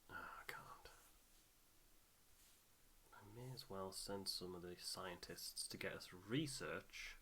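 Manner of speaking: usual speaking voice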